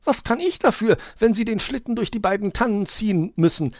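ordinary delivery

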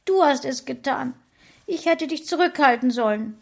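wife sobbing, blaming husband; sobbing blame. A narrator's reading. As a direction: blame him through sobs. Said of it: "schluchzte mein Frauchen".

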